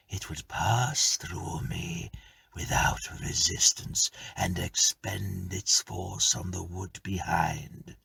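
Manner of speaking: slow, whispery spirit; ghost voice. Acted. Ghost explaining to tenant, slow and whispered. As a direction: let it drift out slow and hushed.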